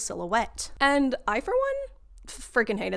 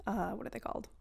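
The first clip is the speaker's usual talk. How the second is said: hushed muttered question; whispering while searching for the word; whispered aside; 12 dB quieter, faster than usual. Real, not acted. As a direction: whisper it to yourself.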